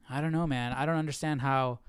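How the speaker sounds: normal talking voice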